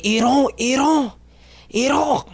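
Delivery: Iran slowly as a very high "ee-RON"; mock "Iran", drawn out high with long open o; exaggerated native pronunciation. Comic refusing to say it natively, exaggerated native pronunciation. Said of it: "I don't have to say".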